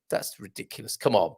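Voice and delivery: normal talking voice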